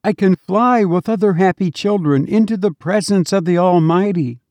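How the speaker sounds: put-on high, sweet little child calling his mother; a small dead child greeting his mother with joy; child's voice. A narrator's reading. Dead child to his weeping mother, high sweet child voice.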